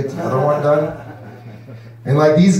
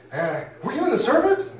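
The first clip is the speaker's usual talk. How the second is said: curious asker; 10 semitones higher, 4 dB quieter than usual. Retold or imitated. People asking him.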